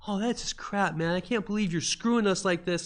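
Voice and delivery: high whiny complaint; complaining players. Retold or imitated. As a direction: gripe at the GM that he's cheating.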